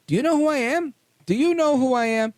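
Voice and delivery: high, loud, indignant "do you know who I am"; mock entitled demand. Councilman mocking the cop with haughty outrage. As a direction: play the pompous official pulled over by a cop. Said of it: "what does he say to the cop".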